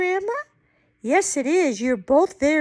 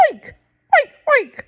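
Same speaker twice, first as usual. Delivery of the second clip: short, high oinks; pig oinking; 9 semitones higher, 5 dB louder, faster than usual. Acted. Grandma reads what the pig says, oinking high. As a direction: oink like an excited pig. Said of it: "The pig says".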